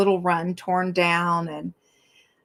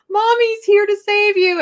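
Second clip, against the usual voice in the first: much higher and louder, sing-song mothering; doting mom voice; 13 semitones higher, 7 dB louder than usual. Retold or imitated. Mom hearing her grown son got cut, doting coo. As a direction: coo like a mother rushing to a hurt child. Rushing out with Bluey Band-Aids.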